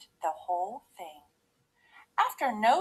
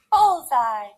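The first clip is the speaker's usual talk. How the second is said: loud, delighted exclamation; triumphant cry; 3 semitones higher, 10 dB louder than usual. A narrator's reading. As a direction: cry out as if you hit the target. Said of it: "she cried".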